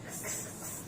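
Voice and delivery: x breathed as a soft unvoiced ks; breathy x. Shown as a demonstration. Teacher showing the x sound. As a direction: hiss the x softly. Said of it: "that's the sound".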